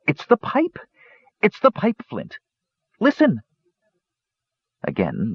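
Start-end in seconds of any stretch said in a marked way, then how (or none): 0.1-3.4 high, breathless kender voice; excited kender. A narrator's reading. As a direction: urge Flint to listen, high and thrilled. Tass urging Flint excitedly.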